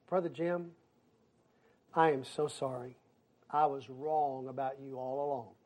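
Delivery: low, soft mock confession; contrite apology. Returning church member apologizing to the pastor, low contrite imitation. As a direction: say it small and humbled, imitating a penitent.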